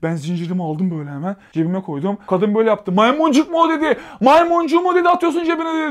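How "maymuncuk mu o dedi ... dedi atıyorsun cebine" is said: high, loud accusing yell; accusing shout. Retold or imitated. Woman accusing him of pocketing a lockpick, loud and high. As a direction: snap at him like a suspicious woman.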